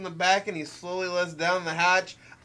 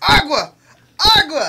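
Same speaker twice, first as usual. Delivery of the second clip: loud, high fake panic yell; mock screaming Spanish; 7 semitones higher, 9 dB louder than usual. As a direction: holler it like a fleeing man. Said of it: "screaming just different things".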